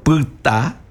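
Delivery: "puta" ending on an open oral a, first vowel a Guaraní y; slow "puta" with a non-nasal final a. Shown as a demonstration. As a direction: say "puta" as pyta, no air through the nose. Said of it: "con un tono oral".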